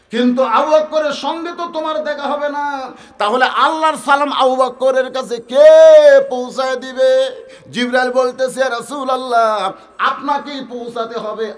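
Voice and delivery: high-pitched sermon storytelling; impassioned preaching. Acted. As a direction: preach the question out to the crowd. Preacher at full force.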